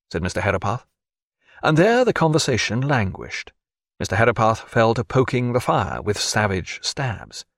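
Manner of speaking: usual speaking voice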